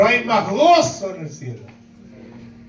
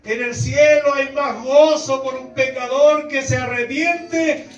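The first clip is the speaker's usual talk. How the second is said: calling out; 6 semitones higher, slower than usual. Retold or imitated. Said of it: "es para decirle".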